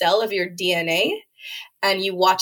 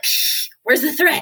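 dismissive; 7 semitones higher, 3 dB louder than usual. Retold or imitated. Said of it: "We're like".

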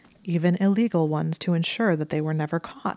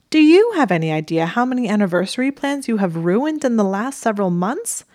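sharp, loud, higher retort; crisp; 5 semitones higher, 7 dB louder than usual. A narrator's reading. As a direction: snap it back crisply. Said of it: "she asked crisply".

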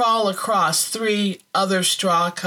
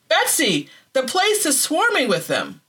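higher, urgent alarm; 7 semitones higher than usual. A narrator's reading. Woman warns sister of swarming fleas, alarmed. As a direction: tell her sister in rising alarm.

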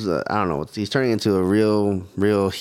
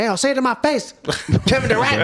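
Steve Nash; 13 semitones higher than usual. Retold or imitated. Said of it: "I wonder how Steve Nash felt".

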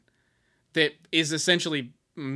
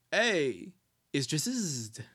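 mock Jersey delivery, drawn-out buzzing "Drizzt"; New Jersey accent; 4 dB quieter, much slower than usual. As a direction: imitate a Jersey guy announcing a name. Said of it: "dude from New Jersey".

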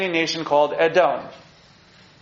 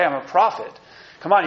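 ordinary talk

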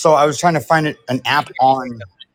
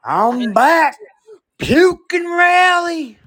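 high, loud character shout, stretched out at the end; drawn-out, high-pitched rowdy yell; Billy Bob; 14 semitones higher, 7 dB louder, much slower than usual. Acted. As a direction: holler it as a boisterous character. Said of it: "I can do Billy Bob".

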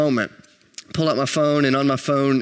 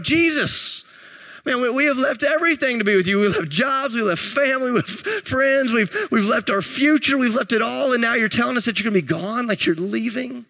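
high-pitched, incredulous pleading; mock dismay, pitched high and insistent; acted dismayed protest; 7 semitones higher than usual. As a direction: imitate followers protesting in disbelief. Disciples protesting to Jesus, acted.

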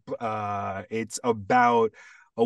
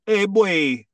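put-on high, loud Vincentian hey boy; sung-out Vincentian accent greeting; 9 semitones higher, 6 dB louder than usual. Shown as a demonstration. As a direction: call out the Saint Vincent way. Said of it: "in Saint Vincent, they might be like".

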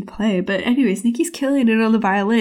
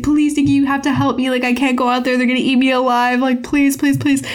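higher-pitched, imploring mock plea; mock groveling; 4 semitones higher than usual. Pepper Ann groveling at Nikki's feet, mock-desperate begging. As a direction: beg desperately, hammed up. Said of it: "groveling at her feet".

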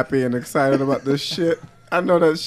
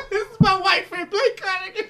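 high, wheezing with laughter; laughing; 5 dB quieter than usual. Real, not acted. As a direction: introduce him while cracking up. Mock introduction while laughing.

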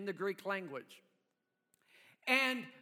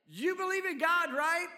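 lifted, louder coaxing question; higher questioning Jesus; 7 semitones higher, 4 dB louder than usual. Retold or imitated. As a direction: ask it brightly, higher and louder. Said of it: "he was asking".

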